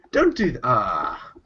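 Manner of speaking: ordinary delivery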